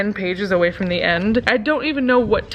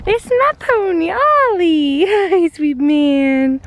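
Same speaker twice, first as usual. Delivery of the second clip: much higher, louder, slow cooing; pet talk; 7 semitones higher, 5 dB louder, much slower than usual. Real, not acted. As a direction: gush over the pony. Introducing and greeting her pony.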